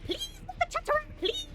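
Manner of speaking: tiny, very high begging voice; squeaky pleading. Pretend.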